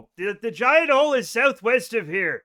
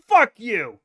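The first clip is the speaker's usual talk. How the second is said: louder, biting curse at the article; angry curse; 7 dB louder than usual. Real, not acted. Streamer swears at a punny headline, loud and angry. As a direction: spit it at the headline.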